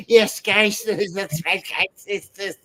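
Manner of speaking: high lispy nerd voice. Retold or imitated. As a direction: do the nerdy comic-book guy with a lisp. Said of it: "the typical, like, nerd voice people do".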